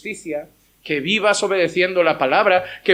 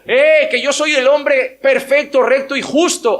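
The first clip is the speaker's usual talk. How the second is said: mock boasting; 6 semitones higher, 4 dB louder than usual. Mocks a boastful claim.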